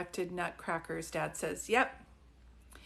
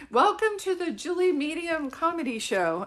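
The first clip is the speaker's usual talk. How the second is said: higher, louder host announcement; mock show host; 7 semitones higher, 7 dB louder than usual. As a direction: hype it like a comedy show opener. Joking show host intro.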